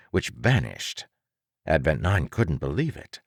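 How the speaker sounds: ordinary delivery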